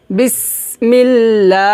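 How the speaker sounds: "বিসমিল্লাহ" recitado devagar, com o লা final esticado; LA alongado. Shown as a demonstration. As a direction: prolongue o লা de "বিসমিল্লাহ", em recitação lenta. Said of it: "লা এক লিফ টান হবে".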